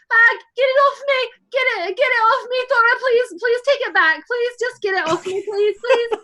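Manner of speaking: high frantic begging, half laughing; panicked pleading. Acted. [name] begs Thora to take the bird off her, high frantic pleading. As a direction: beg in a rush to get it off. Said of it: "and I'm just like".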